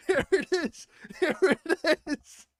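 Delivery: very high, squeaky with laughter; drawn-out, high giggly gloating; gleeful laughing. Real, not acted. Laughing over the found proof.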